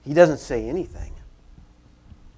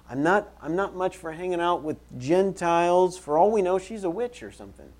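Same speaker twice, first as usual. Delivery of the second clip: higher, impatient grumbling, voiced for them; dismissive disciples; 6 semitones higher, 3 dB louder than usual. Disciples complain about the Gentile woman, dismissive. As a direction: play disciples eager to be rid of her. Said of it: "compassionate guys that they are, they say".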